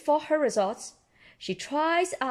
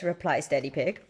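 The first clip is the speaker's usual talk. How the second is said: plain reading voice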